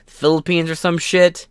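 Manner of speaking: normal talking voice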